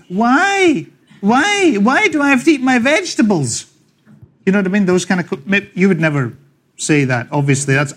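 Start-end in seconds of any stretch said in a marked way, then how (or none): 0.1-3.6 put-on high-pitched child pestering a parent; whining small kid. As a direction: draw out each why like a protesting kid. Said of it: "usually small kids, say to their parents".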